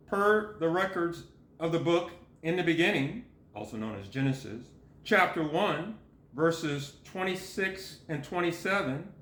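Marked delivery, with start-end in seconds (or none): 3.5-4.7 low, quick, softer aside; parenthetical aside. Real, not acted. Reader glossing a book title, lowered aside. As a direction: drop the pitch and volume, slip it in quickly.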